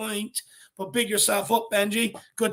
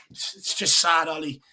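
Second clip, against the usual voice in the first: ordinary talk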